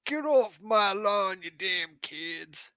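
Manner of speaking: put-on high, slow, soft old-timer voice; old person voice. Shown as a demonstration. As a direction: drag it out feebly like an old geezer. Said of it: "that's my best old person voice".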